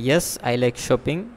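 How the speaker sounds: slow, clipped model answer; unhurried example reply; slow weak answer. Shown as a demonstration. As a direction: show the too-short answer slowly. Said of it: "আমি answer দিলাম যে".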